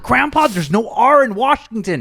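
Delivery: high, exasperated protest; driven nuts. Retold or imitated. Grandson correcting grandpa, high exasperated whine. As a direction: plead in mock despair. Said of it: "It drove me nuts".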